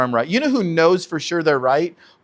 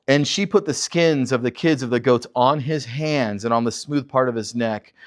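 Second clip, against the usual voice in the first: normal talking voice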